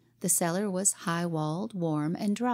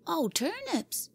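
put-on slow, high relieved boy's cry; a boy whose family honor is saved; relieved exclaim; 11 semitones higher, slower than usual. Jimmy answering Cora: relieved slow exclaim. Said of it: "exclaimed Jimmy".

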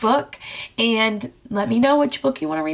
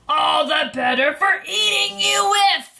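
hammed-up wolf bellowing at the girl; wolf's roar; 8 semitones higher than usual. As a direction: pounce with a big villain shout.

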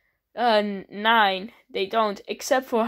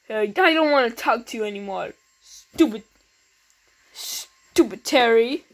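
ordinary delivery

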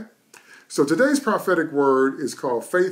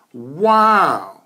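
amazed wow; 3 semitones higher, 7 dB louder than usual. Acted. Preacher marvels again.

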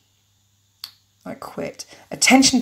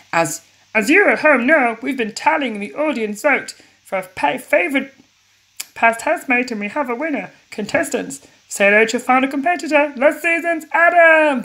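TV host; 5 semitones higher, 4 dB louder than usual. Acted. Host reveals the final contestant.

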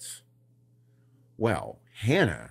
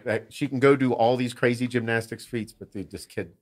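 ordinary delivery

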